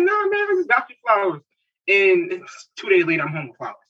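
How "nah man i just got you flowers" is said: high mock-dismissive voice, imitating himself; brushing off his wife's flower request with a high teasing voice; playful brush-off. Husband teasing wife about flowers, high mock brush-off. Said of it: "And I'm like".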